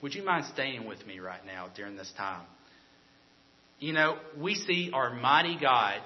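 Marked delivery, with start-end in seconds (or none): 0.0-2.5 soft, low, gentle request; tender. Retold or imitated. Jesus asking a disciple to stay with him, tenderly. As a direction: quietly ask a friend to stay, voice dropped. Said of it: "just tenderly".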